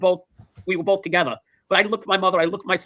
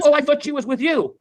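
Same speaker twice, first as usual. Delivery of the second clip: high-pitched retold dismay; acted sister's worried protest; dismayed realization; 7 semitones higher than usual. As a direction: blurt it out in mock alarm. Sister realizes mother is missing.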